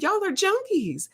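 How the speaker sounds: very high drawn call-out; teasing high call-out. Retold or imitated. Repeating the jab to the nurse, very high teasing. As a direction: squeal it up high in mock disbelief. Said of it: "I started laughing 'cause she knew it was true".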